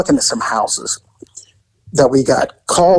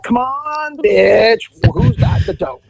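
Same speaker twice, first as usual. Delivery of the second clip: high, rough demanding voice; lightly voiced street hustler; imitating a bossy buyer; pushy hustler; 11 semitones higher than usual. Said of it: "He's like".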